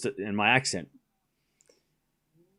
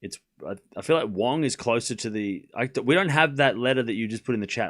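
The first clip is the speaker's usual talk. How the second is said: ordinary delivery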